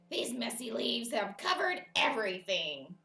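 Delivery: loud character voice for Toad; grumbling Toad. Acted. Toad looks out at the messy leaves, grumbling loudly.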